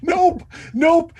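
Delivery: high, loud character yell; yelled refusal. Acted. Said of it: "Luther yells out".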